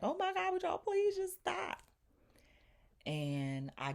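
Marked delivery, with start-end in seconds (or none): 0.0-1.8 very high, loud mock-distressed plea; imitated crying scream. Imitating Demi begging the fighters to stop, screamy and high. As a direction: mimic a friend wailing for it to end. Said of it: "is crying and screaming and like".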